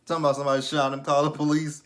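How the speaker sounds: mild mock yell; retold screaming. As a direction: imitate a panicked shout, held back. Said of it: "came out the house screaming".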